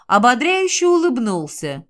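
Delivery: usual speaking voice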